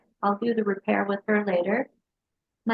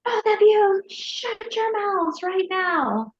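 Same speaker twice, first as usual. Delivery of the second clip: much higher, slow, drawn-out command; retold scream at the kids; 10 semitones higher, slower than usual. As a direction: replay losing her temper in the car. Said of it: "I screamed".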